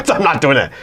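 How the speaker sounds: refusal through a laugh. Real, not acted. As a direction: say it while laughing.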